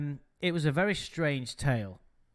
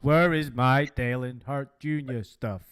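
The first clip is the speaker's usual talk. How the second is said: mock robot voice, lightly done; a playful stiff robot voice; 4 dB louder than usual. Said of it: "Maybe she had a robot voice".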